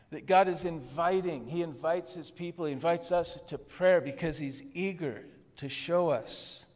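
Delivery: ordinary talk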